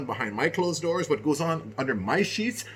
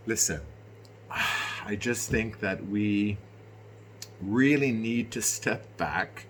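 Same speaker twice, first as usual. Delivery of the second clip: ordinary delivery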